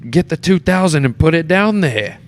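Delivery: high, loud yelled line, imitating a huge man screaming; retold scream. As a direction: bellow it in his face like a booming stage uncle. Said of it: "he screams at me".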